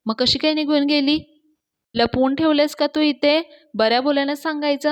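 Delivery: वरच्या पट्टीत, मोठ्याने जाब विचारणे; धमकी देत चढ्या आवाजात विचारा; रागाने दरडावणे. Acted. विवान मालकाला धमकावतो. (मालकाला धमकावणारा विवान, चढा रागीट आवाज)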